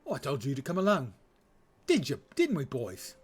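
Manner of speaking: hammed-up working-class British accent. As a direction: play a British ruffian rallying his mates. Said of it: "lower class British accent".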